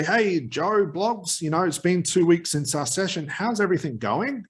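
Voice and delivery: uneasy follow-up call tone that plants doubt; doubtful inflection. Shown as a demonstration. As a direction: sound unsure whether it worked. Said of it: "Hear the inflection in my voice?".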